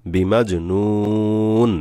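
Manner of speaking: বিমাজ নুন very slow, long u closed on n; stopped ending. Shown as a demonstration. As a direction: hold the vowel in নুন, then stop. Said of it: "ওয়াকফ করলে". (বিমাজ নুন, টেনে শেষে সাকিন)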